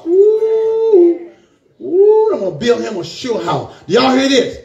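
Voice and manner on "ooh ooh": long high "ooh" of elation; excited whoop. Real, not acted. Thrilled by the scripture promise.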